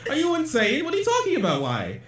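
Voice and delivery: high-pitched, appalled questioning; incredulous challenge. Real, not acted. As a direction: demand an answer in disbelief. Incredulous about flushing.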